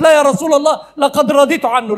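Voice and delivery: high, loud, desperate plea; mother begging the Prophet to spare her son; mother's pleading cry. Acted. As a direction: cry out to stop him, begging. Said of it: "قالت".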